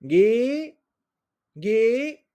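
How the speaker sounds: "gué" high with a closed E; closed E example. Shown as a demonstration. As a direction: model "gué" with a tight E. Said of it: "on va faire".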